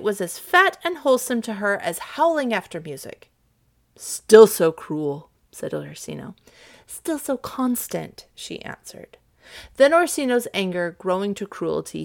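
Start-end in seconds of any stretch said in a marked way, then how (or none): 4.0-5.3 loud, slow, heavy reproach; reproachful Duke. Acted. Duke to the woman refusing him, slow reproach. 6.9-8.2 higher, slow, measured reply; cool lady's retort. Acted. Olivia answering the Duke's suit, slow retort.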